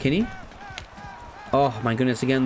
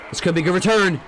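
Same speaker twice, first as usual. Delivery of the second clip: rising excitement; 6 semitones higher, 4 dB louder, much faster than usual. Real, not acted. Return building, said again louder.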